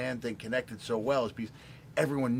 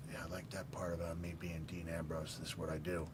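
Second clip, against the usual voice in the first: retold whisper, much softer and lower; hushed reply; 5 semitones lower, 12 dB quieter than usual. [name] retelling Dean's awed answer, a soft whisper. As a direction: breathe it like a hushed, impressed aside.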